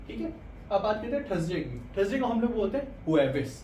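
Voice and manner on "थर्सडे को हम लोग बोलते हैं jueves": ordinary delivery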